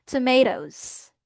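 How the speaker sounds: "tomatoes" slow, as tuh-MAY-doze with a buzzed Z ending; "tomatoes" drawn out, falling, ending in Z; American model pronunciation. Shown as a demonstration. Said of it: "Ganun siya".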